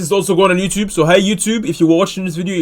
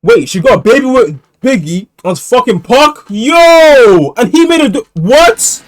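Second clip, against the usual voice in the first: loud, high-pitched disbelief; shocked outburst; 5 semitones higher, 7 dB louder, slower than usual. Real, not acted. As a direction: shout it out in stunned disbelief. Shocked reaction to the story.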